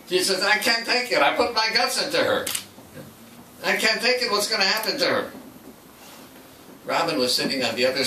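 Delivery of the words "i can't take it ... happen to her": raised, anguished retelling of a tearful plea; mild mock distress, voice pitched up; imitating crying. Therapist crying over the patient, a touch of tearful pleading. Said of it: "starts crying".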